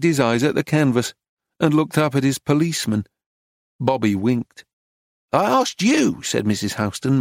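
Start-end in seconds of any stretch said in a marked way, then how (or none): 5.3-6.2 "I asked you" high and emphatic; mouthed syllables. A narrator's reading. Dealer repeats to silent painter, "I asked you" in mouthed syllables. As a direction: set out each word of "I asked you", pitched up. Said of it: "mouthing her syllables".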